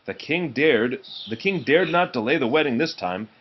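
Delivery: usual speaking voice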